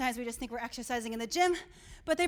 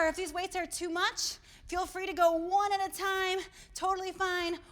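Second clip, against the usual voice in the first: ordinary talk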